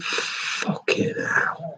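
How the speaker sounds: slow hushed whisper of disbelief; whispered astonishment. Retold or imitated. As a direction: breathe it out, stunned. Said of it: "you think".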